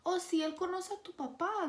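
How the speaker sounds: high, brightly reassuring relatives; a lightly voiced relative playing it down. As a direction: reassure her that he knows her dad.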